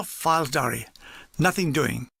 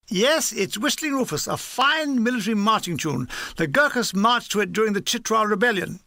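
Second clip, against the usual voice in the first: higher-pitched, proud officer voice; enthusiastic army major; 3 semitones higher than usual. Acted. Major telling two gunners about a marching tune, proudly enthusiastic. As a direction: extol the tune like an old campaigner.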